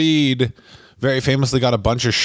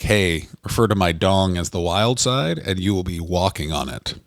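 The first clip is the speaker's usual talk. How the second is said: mock seduction line; 7 semitones lower than usual. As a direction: croon it low like a cheesy lover.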